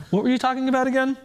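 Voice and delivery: high, fast and bright-eyed blank; dazed rooster. Acted. Rooster forgets the chicken killing and asks high and fast. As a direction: chirp it out cheerfully with no memory.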